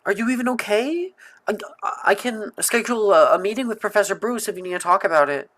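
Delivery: high, fast, fretting schoolgirl; worried girl voice. Acted. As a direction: rush it out with worry. Character concern, high voice.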